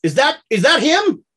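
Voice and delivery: high, loud accusing challenge; accusing confrontation. Acted. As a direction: confront the man who shot at your wife. Confrontation between characters.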